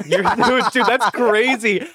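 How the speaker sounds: high voice breaking into laughter; laughing through the words. Real, not acted. Laughing at the fake crying bit.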